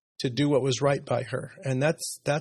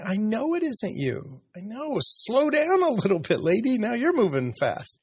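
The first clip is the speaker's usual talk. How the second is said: higher, lightly teasing retold reply to his wife; playful mock scolding; 6 semitones higher than usual. Husband retelling his reply to Sarah's letter, teasing. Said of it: "And I'm going".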